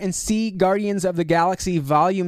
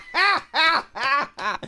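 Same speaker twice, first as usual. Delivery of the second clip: big booming laugh; 8 semitones higher than usual. Retold or imitated. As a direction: laugh like Drax. Imitating Drax laugh.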